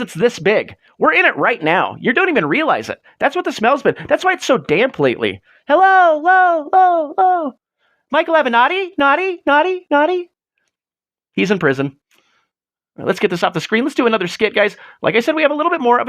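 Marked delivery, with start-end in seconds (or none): 5.7-10.3 teasing sing-song. Pretend. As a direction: call out playfully like a tease.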